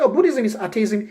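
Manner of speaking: acted courteous acknowledgement; very polite. Showing how to answer wrong ideas, politely high-voiced. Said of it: "very politely".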